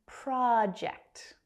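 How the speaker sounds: slow American-style model of project; American pronunciation. Shown as a demonstration. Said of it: "which is more common in America".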